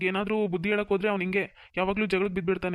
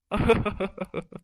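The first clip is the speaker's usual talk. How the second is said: villain laugh. Acted. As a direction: cackle in triumph over the fooled girl. Villain gloats after tricking her. (ಖಳನಾಯಕನ ಕ್ರೂರ ನಗು)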